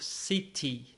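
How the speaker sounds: British "city" with a crisp clear t; British English. Shown as a demonstration. Teacher contrasting American and British "city". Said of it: "Em inglês britânico".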